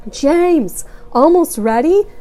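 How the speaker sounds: loud distant call; calling from afar. A narrator's reading. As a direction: call from another room. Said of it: "voice echoed distantly".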